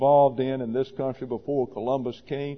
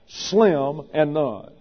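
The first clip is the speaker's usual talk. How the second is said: slim and none, slowed, none stressed; slow and heavy on "none"; much slower than usual. Shown as a demonstration. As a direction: drag out "slim and none" and lean on none. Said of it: "with emphasis on none".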